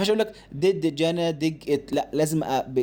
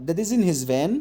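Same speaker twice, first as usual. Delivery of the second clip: fast reading; 3 semitones higher, slower than usual. Shown as a demonstration. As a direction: read it off quickly, fluent. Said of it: "هقرا بسرعة بقى". (قراءة سريعة)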